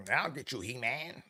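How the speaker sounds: a hint of a whiny cartoon villain; lightly put-on nasal villain taunt; Skeletor's annoying voice. Skeletor taunting He-Man in a mildly whiny villain voice. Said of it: "that real annoying voice".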